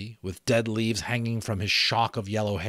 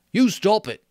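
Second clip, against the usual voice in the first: high, loud cry of Piggy; shrill protest; 13 semitones higher, 9 dB louder than usual. Acted. Piggy shrilly cutting Ralph off. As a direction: snap it out high and frantic. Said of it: "said Piggy shrilly".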